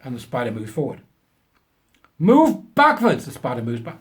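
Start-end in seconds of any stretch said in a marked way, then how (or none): 2.2-3.3 put-on much higher, louder, slow German accent; mock German professor. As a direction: ham up a self-important German scientist. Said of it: "of German extraction".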